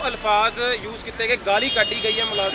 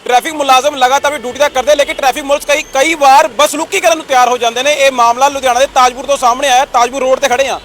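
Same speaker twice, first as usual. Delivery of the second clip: loud, punchy reporter delivery; forceful TV news read; 3 semitones higher, 7 dB louder than usual. Acted. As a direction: sell the story to the camera, louder.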